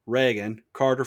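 usual speaking voice

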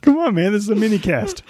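high, words squeezed through wheezing; wheezing laugh. Real, not acted. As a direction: laugh breathlessly while talking. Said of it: "Stop wheezing in the mic".

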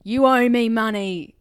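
loud, slow, pushy demand for money; mock demand. Recalling a visitor at the prison, loud slow demand. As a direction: hammer out each word like a crazed visitor.

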